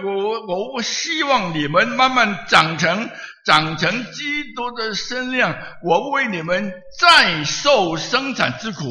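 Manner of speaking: high, loud preaching of Paul's plea; fervent preaching. Acted. Preacher voicing Paul's plea to the Galatian church, loud and high. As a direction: preach Paul's words with rising fervour.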